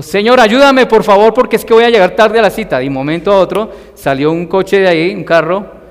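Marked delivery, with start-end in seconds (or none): 0.0-2.8 higher, louder pleading to the Lord; urgent prayer. Retold or imitated. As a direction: beg God for help while running late.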